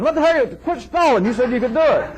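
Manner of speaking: high-pitched, pushy and exasperated; mock impatient customer. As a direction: play the client losing patience with the healer. Demanding customer voiced in anecdote.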